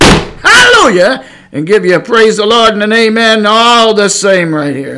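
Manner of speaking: high, loud pulpit praise; ringing preacher's shout; shouted hallelujah. Shown as a demonstration. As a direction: belt it out like a fired-up preacher.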